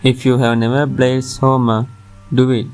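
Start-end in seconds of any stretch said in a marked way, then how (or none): none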